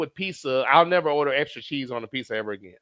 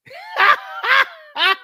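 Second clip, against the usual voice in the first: loud high laughing shout; wild cackle; 7 dB louder than usual. Real, not acted. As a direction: burst out in a howling laugh. Reacts to chat message.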